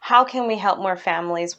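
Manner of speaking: ordinary talk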